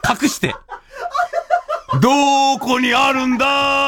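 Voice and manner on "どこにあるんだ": drawn-out mock yell. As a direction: drag it out like a comic wail. Searching, drawn out.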